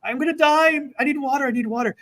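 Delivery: gasping runner. Retold or imitated. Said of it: "And she's like".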